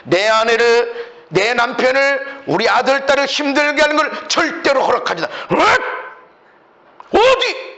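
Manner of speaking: defiant, escalating scolding; demonstrated rebuke. Shown as a demonstration. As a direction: forbid the enemy, phrase by phrase. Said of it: "꾸짖어야 돼".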